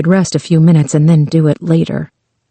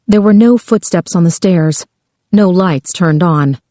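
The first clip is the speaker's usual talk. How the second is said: plain reading voice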